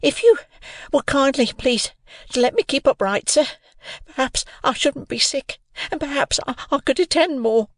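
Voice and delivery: put-on high, giddy little boy's plea; dreadfully frightened. A narrator's reading. Giddy Pip clings to the convict with both hands, frightened. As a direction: plead haltingly in a high, scared child's voice. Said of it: "I was dreadfully frightened".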